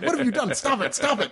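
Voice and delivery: half-laughing mock plea to stop; laughing mock protest. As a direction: play a fan overcome by the actor, laughing. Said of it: "I was like".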